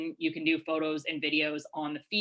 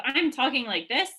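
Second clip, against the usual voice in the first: pitched up higher and a bit louder; high tone demo; 7 semitones higher, 4 dB louder than usual. Shown as a demonstration. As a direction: show what a really high voice sounds like. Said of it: "that's, like, a really high tone".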